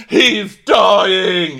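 high, loud hysterical wail; mock panic cry. Everyone else panicking over injured Kyle, high loud mock cry. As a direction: overdramatic shout of alarm. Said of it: "like everyone else".

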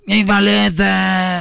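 high, drawn-out imitation of a kid yelling; screaming child. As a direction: play a child wailing for candy. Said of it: "Imagínense un niño gritando". (imitando a un niño gritando)

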